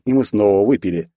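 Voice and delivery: usual speaking voice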